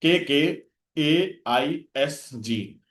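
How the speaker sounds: slow letter by letter, each letter drawn out; slow teacher's mnemonic spelling; spelled-out mnemonic. Shown as a demonstration. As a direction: spell the memory code very slowly for students. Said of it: "तो क्या, कैसे याद रखेंगे?".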